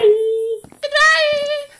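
very high, sweet little voice; a squeaky, cute goodbye. Retold or imitated. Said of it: "essa mulher tem uma vozinha fofa".

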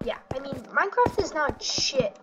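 usual speaking voice

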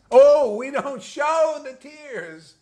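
high, loud, very slow smug drawl; mock smug teacher. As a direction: ham up the prim self-satisfied answer.

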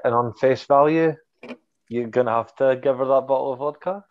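usual speaking voice

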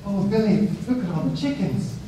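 lightly voiced wife, childlike Christmas wonder; a hint of a wide-eyed little girl seeing chickens; mild mock childlike delight. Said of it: "these wide-eyed, like, little girl Christmas".

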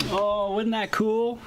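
high-pitched, real delight; delighted gushing. Real, not acted. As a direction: gush over the pet's little visit. Gushing after pet's visit.

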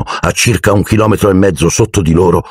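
usual speaking voice

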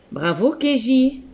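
high, cheerful praise for Keji; bright praise. Real, not acted. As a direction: cheer the pupil on. Teacher praising a child. (bravo enjoué)

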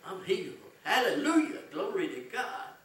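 high, loud joyful shout; loud praise. Shown as a demonstration. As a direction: shout your healing out loud. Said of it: "With a loud voice".

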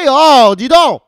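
loud, high indignant outburst; hammed-up indignant shout; mock scolding. Host scolding viewers not yet subscribed, mock outrage.

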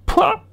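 very high, loud shiver of disgust; the lory shuddering at the dry lesson; shuddering disgusted pouah. A narrator's reading. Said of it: "en frissonnant".

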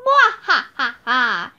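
low villain's mwah-ha-ha; evil laugh. A narrator's reading. Mummy's head gloating over the kids, evil laugh. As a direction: cackle like a gloating monster. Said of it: "said Marvin's head".

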